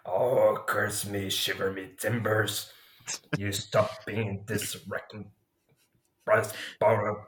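rough, throaty pirate voice. Shown as a demonstration. Podcast host trying out pirate slang, rough throaty voice. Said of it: "this rough voice".

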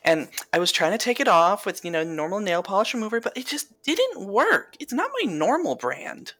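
put-on high, bubbly Valley Girl; gushing Valley Girl rant. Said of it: "if you wanna do Valley Girl".

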